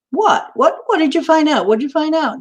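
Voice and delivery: high-pitched eager dog in a joke; excited joke dog. Acted. Dog begging the cat for what it found out, high and eager. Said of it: "And the dog says".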